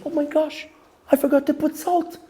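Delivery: put-on high, light young girl's voice; daughter's voice. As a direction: play the flustered daughter.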